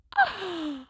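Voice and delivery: sharp, high gasp of delight. Pretend. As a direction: gasp as if overcome by cuteness. Showing off her favourite crochet piece.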